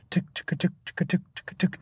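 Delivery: soft, voiceless "tuk tuk" ticking; imitated drum tick. Shown as a demonstration. Explaining background timekeeping drums, softly ticked percussion.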